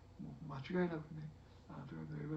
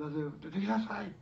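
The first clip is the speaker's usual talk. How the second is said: loud call; 5 semitones higher, 9 dB louder than usual. A narrator's reading. Jesus calling Lazarus out of the tomb, a loud command. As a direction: call out loudly, pitch up. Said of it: "と大声で呼ばれた".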